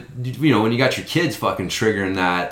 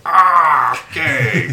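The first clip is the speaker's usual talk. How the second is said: high, loud startled ah, okay; a jolt of surprise, then backing off; 6 semitones higher, 4 dB louder, much slower than usual. Retold or imitated. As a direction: catch yourself at the insult. Calming a rude kid.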